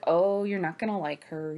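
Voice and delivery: usual speaking voice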